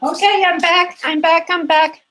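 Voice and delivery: high, eager calling out that she is back; excited return. Real, not acted. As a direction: announce you're back, brightly and a bit breathless. Returns to camera after fetching towels.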